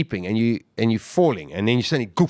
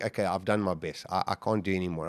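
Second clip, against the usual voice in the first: resigned giving up; 4 semitones lower, 9 dB quieter than usual. Retold or imitated. Giving up while floating, exhausted.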